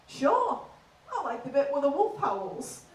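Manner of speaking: laid-back Yorkshire fella; simple, unbothered Yorkshire delivery; Yorkshire accent. Retold or imitated. Yorkshire man explaining his return, in a laid-back Yorkshire accent. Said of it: "Northern is quite a laid-back accent".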